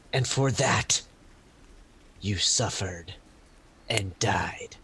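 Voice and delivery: slow, solemn pronouncement with long pauses; measured verdict, sinking to a whisper at the end; Illume. Acted. Illume stepping back to talk it down, solemn and slow. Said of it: "Illume just steps back and".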